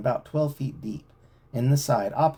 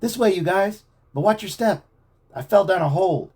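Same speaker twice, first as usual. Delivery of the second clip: loud, higher call; calling up from below; 9 semitones higher, 6 dB louder than usual. A narrator's reading. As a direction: shout up out of a pit. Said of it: "Joe's muffled voice reached their ears".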